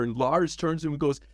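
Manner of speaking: usual speaking voice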